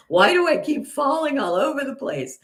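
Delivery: high-pitched mock whine of a small child; imitating upset toddler. Voicing her crying toddler grandson.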